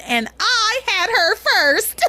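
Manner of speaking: high, drawn-out mock whine; childish complaint, hammed up; mock indignant wail. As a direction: stretch it out high like a wronged kid. Acting Luis's quarrel with mother.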